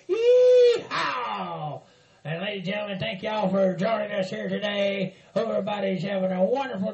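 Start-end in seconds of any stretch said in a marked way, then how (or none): 0.1-1.8 a high, loud whooping yell; cowboy yee-haw. Acted. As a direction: holler it like a rodeo cowboy. Host's opening cowboy holler.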